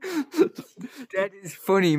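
slow, drawn out through laughter; laughing. Real, not acted. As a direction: laugh the words out slowly. Laughing at the joke.

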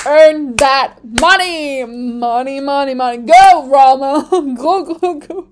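loud chant with money drawn out; playful hype, breaking into laughter; root for him like a rowdy fan; cheering him on. Pretend. Cheering on driver's lawsuit.

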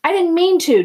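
high, loud angry shout; mocking, yelled echo. A narrator's reading. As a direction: throw the excuse back at him furiously. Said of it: "Derry shouted".

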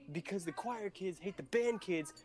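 ordinary talk

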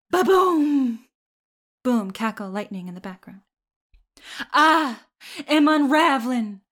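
a hint of a mild southern accent, dramatic; Carrie's mom, southern accent. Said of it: "you really did a good job, uh, with the accent".

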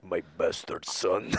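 put-on slow, low Russian villain accent.

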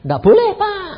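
loud, high refusal at the counter; retold official's refusal. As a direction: bark it like a strict gate officer. Petugas bandara menolak tegas. (petugas menolak dengan keras dan tinggi)

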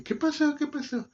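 frightened grandma calling out, high; alarmed grandmother. Retold or imitated.

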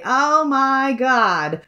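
slow, high nasal-free drawled oh my god; Janice from Friends. Retold or imitated. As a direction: stretch it out like the sitcom catchphrase. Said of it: "quote Janice from Friends".

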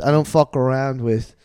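ordinary talk, nothing marked

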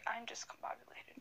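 a flustered apology under her breath, whispered. Real, not acted. Apologises to her co-host for losing the name, whispered. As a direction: whisper it, embarrassed, to yourself.

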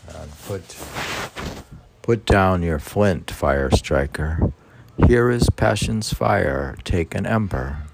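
slow, hushed poem recital sinking low; poem reading. Acted. Reads translated poem aloud.

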